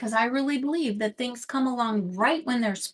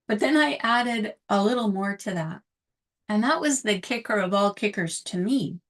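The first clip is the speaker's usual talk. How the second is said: ordinary talk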